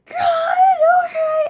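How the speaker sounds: high, loud shout over the din; loud shouting over noise. Retold or imitated. Teen shouting greetings over a loud blow dryer, loud shout. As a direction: yell the small talk over a roaring blow dryer. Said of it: "talking to everybody like".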